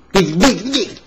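very high, loud rising bigs; emphatic big. Pretend. Boy joking about big noses, very high loud bigs. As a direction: exaggerate each big.

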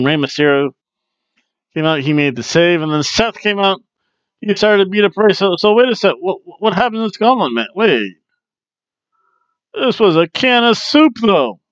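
ordinary delivery

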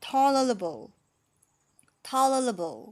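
"tolerable" with a high loud TALL, falling ler-uh-bul; whole-word model. Shown as a demonstration. Teacher joins the syllables up, whole-word model. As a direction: punch the start of "tolerable" and let it fall. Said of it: "อันนี้อ่านว่าไงนะคะ".

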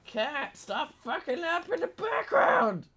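high, exasperated yell at the cat; sudden high-pitched angry holler; real scolding shout. Real, not acted. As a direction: yell at a pet to quit it, stretched and high. Snaps at noisy cat.

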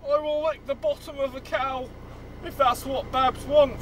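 soft, cowed recital; hushed, submissive vow under duress; reluctant repeating. Acted. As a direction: give in quietly, against your will.

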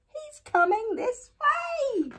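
high, voiced squeal of fear; frightened, supposed whisper. A narrator's reading. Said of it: "whispered Eddie".

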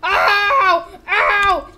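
high, very loud wounded wail; hammed-up agonised yelling; screaming in pain. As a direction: shriek as if just shot. Character shot in the stomach.

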